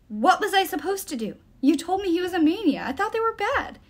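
high, heated self-defence; defensive protest. A narrator's reading. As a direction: protest indignantly, pitch climbing. Will defends himself in a quarrel.

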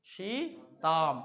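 সীতাম stretched out very slowly, every sound held; slowly drawn out. Shown as a demonstration. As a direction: drag out সীতাম so the class hears the ending. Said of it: "Sita না হয়ে সীতাম কেন হলো".

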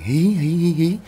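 high-pitched imitated ghostly "hee hee"; eerie giggle. A narrator's reading. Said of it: "có một cái tiếng cười". (tiếng cười ma hí hí the thé)

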